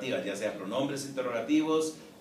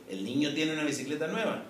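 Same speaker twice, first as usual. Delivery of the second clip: rising question tune on nueva, same word order; question intonation; 4 semitones higher, faster than usual. Shown as a demonstration. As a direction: raise the pitch on "nueva" to turn the statement into a question. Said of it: "Se cambia la entonación de la voz".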